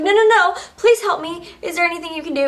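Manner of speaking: frantic please help me; retold desperate plea. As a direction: beg as if it's slipping away. Begging the clerk for help.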